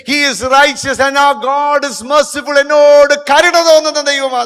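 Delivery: loud, high sermon voice, building; a pastor proclaiming God's mercy, loud and high; fiery preaching. Acted. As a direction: preach the mercy of God with full force. Sermon rises again.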